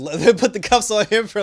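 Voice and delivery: high, loud words through real laughter; laughing while talking. Real, not acted. Recapping an arrest for no reason, laughing.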